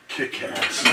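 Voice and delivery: ordinary talk, nothing marked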